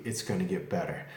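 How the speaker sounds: usual speaking voice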